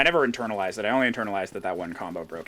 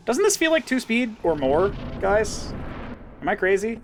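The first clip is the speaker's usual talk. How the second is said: incredulous; 11 semitones higher, 3 dB louder than usual. Real, not acted. Asks chat incredulously.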